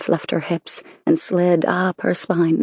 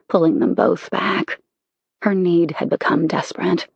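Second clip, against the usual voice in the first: ordinary delivery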